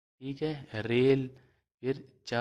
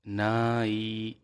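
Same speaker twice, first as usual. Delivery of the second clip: drawn-out blend; much slower than usual. Shown as a demonstration. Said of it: "حمزہ یا زیر ای".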